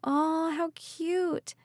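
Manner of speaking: cooing thought. Acted. Ridley's inner voice cooing at Ember in the jacket. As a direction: coo it, melting at the puppy.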